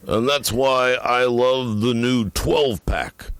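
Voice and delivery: slow, high-pitched parody pitchman; mock radio endorsement; mock ad read. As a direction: sell it slowly with drawn-out, overblown radio polish. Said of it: "it's sort of like this".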